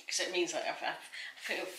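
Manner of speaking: usual speaking voice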